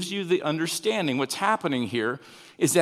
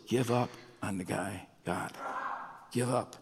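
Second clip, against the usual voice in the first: much softer and lower, as if sighing it to himself; muttered give-up; 6 semitones lower, 10 dB quieter than usual. Retold or imitated. Preacher voicing his own frustration with Jonah, softly muttered. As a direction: drop the voice, a weary aside. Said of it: "I'm, I just go".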